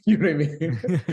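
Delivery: real laugh spills over the question. Real, not acted. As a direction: laugh through the words. Laughing while making a point.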